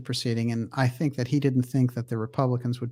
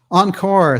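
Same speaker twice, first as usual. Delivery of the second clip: exclaimed encore; 7 semitones higher, 9 dB louder than usual. Acted. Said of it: "I like that".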